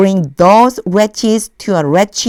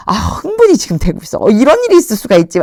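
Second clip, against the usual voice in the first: quick, higher excited outburst; getting excited; 4 semitones higher, much faster than usual. Real, not acted. Teacher caught up in the parable, excited rush. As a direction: swept up in the story, words rushing. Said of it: "제가 계속 목소리가요".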